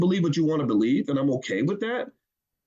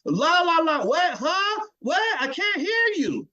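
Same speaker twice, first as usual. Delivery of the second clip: high, slow singsong pretending not to hear; mocking la-la not listening; 12 semitones higher, slower than usual. Politicians mocking a voter with a high la-la, pretending not to hear. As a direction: drown them out like a child. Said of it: "That's what they did".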